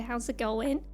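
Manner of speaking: ordinary delivery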